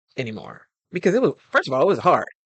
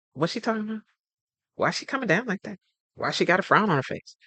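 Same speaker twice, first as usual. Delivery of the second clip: higher, puzzled mock listener asking; lightly voiced confused listener, pitched up; 6 semitones higher, faster than usual. The speaker imagining listeners puzzled by her mood, a higher mock-confused voice. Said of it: "they don't sound like".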